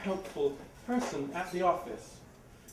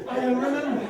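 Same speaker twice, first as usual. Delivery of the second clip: dazed and flustered; 7 semitones higher, 9 dB louder than usual. Retold or imitated. Dazed after the gunfight.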